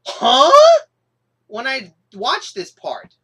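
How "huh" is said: much higher, much louder baffled huh. Pretend. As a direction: blast out a resounding huh. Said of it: "a resounding and loud".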